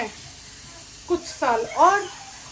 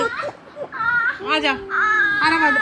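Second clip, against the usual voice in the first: drawn-out calling; 6 semitones higher, much slower than usual. Real, not acted. Mother calling little Aarav to her lap, long drawn-out call. Mother calls toddler to come.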